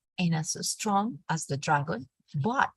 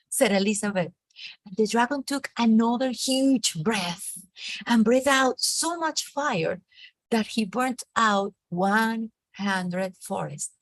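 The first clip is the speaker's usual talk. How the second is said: ordinary talk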